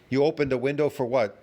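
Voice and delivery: usual speaking voice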